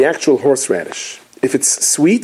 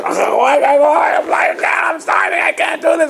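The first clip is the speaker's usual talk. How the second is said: much higher, slow drawn-out shout; mock starving cry; 12 semitones higher, much slower than usual. Hungry guest at the Seder crying out, much higher hammed-up shout.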